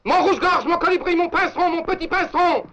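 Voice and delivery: pet names called out loud and high; forced loving shout, breaking into a laugh. Acted. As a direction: belt the bird names so the hall hears. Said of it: "Plus fort".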